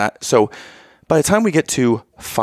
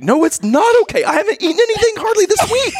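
exasperated outburst. Retold or imitated. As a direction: burst out at her, fed up. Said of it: "I'm like".

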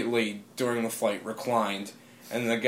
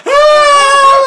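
long, high, loud scream; excited scream; 16 dB louder than usual. Retold or imitated. Imitating people running from airport stores, excited scream. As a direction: scream like someone running to celebrate.

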